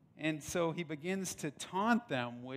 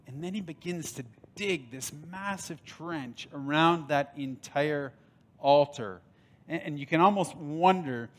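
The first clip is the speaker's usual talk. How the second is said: louder, driving sermon voice; preaching; 7 dB louder than usual. Acted. Pastor tells the altar story to the church, building fervour. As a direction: build the story toward its climax.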